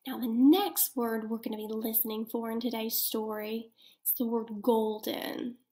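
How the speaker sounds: ordinary talk, nothing marked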